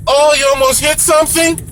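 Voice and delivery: high-pitched, imitated startled question; passenger's voice.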